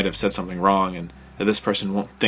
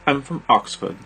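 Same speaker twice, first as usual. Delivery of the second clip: put-on British accent; 5 semitones higher, 4 dB louder than usual. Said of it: "she said".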